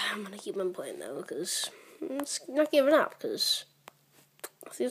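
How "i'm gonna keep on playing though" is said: usual speaking voice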